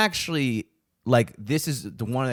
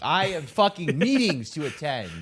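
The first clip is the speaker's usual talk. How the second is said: higher-pitched mock-important excuse; mock self-importance; 5 semitones higher than usual. To street canvassers while out for pizza, mock self-important. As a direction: play the busy big shot, pitched up.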